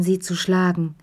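usual speaking voice

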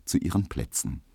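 ordinary delivery

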